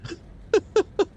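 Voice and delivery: high-pitched giggle burst; high squeaky laugh. Real, not acted. Laughs at joke.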